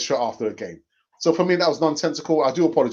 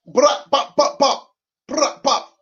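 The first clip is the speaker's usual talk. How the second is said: high, loud mouth-made bangs; punchy rapid vocal pops, high-pitched; imitated gunshot bursts; 13 semitones higher, 7 dB louder, slower than usual. Imitated bursts after a shout-out.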